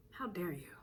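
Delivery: whispered mock outrage. Whispering the offended customer's line. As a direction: breathe it out as a mock aside. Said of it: "That's the vibe".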